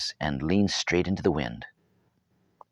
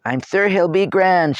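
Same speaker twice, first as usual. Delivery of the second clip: put-on a higher girl's voice, cheerful; lightly voiced girl; 12 semitones higher, 7 dB louder than usual. A narrator's reading. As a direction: reassure him brightly. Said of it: "tucking her chin in just a bit".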